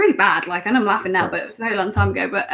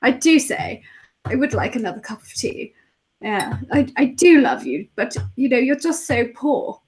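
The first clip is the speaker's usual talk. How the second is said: upper-class English toff; put-on Queen's English; proper English accent; 3 semitones higher than usual. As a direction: play a very proper English snob. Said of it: "the proper English".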